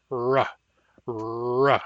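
Ra with a bunched American R; plain ra, rolled-back tongue R; English R. Shown as a demonstration. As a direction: say ra with a strong English R. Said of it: "The English R sound sounds like this".

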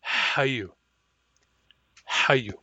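Hayu with a raspy throaty H; Hayu, pharyngeal H onset; back H word. Shown as a demonstration. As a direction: say Hayu with a strong noisy H. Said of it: "as in Hayu".

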